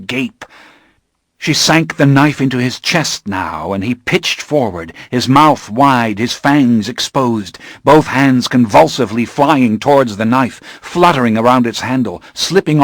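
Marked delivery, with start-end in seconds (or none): none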